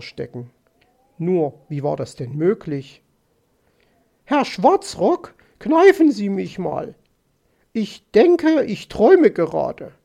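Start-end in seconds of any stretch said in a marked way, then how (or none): 4.2-9.9 high, louder bird-lady voice; stunned magpie lady. Acted. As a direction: squeal lightly in disbelief as Mrs. Magpie. (verblüffte, hohe Elsterstimme)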